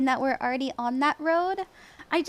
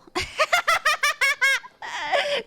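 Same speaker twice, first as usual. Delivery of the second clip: high-pitched burst of laughter; helpless high laughing fit; real laughter; 11 semitones higher than usual. Real, not acted. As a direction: crack up in a long high giggle. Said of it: "I'm laughing about".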